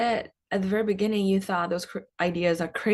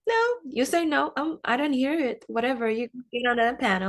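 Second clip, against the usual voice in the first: higher-pitched playful mock insistence; insistent mentor; 7 semitones higher than usual. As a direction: brush off the refusal as the mentor, voice lifted. Acts out insistent mentor overruling her.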